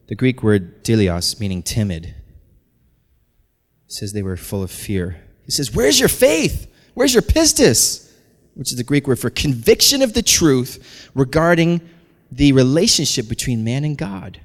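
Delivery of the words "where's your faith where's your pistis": high, raised exasperated demand; Jesus scolding his disciples, voice raised; acted rebuke. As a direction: challenge frightened friends, pitched up high.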